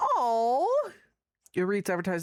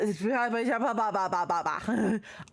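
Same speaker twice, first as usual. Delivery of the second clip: babbling laugh. Real, not acted. Laugh heard.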